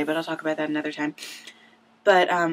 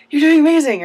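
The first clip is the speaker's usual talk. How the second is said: much higher and much louder, gushing; excited gush; 9 semitones higher, 11 dB louder than usual. Retold or imitated. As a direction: blurt the praise like a thrilled fan. Tweeting praise to the actor.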